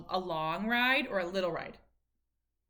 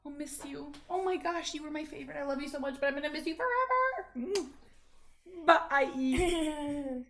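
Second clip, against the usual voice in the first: hammed-up high sorrowful farewell; mock sad goodbye; 6 semitones higher than usual. Mother pretending tearful goodbye sending son off, hammed-up. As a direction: pretend to be heartbroken.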